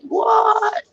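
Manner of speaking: high, incredulous yelp of disbelief; a squeaky what, stunned; imitating her own shocked squeak. Shocked at husband's wish.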